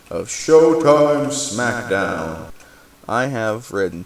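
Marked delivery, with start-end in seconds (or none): 0.1-2.5 slow, louder, drawn-out show-title announcement; announcer voice. Acted. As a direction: boom the title like a wrestling announcer. Announcing the show's segment title.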